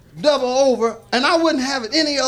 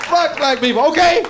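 loud, defiant comic yell, higher; mock shout; 5 semitones higher, 7 dB louder than usual. Comedian mock-agreeing with a bigot, loud shout. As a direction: bellow it at the crowd with a laugh.

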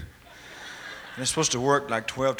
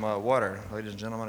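normal talking voice

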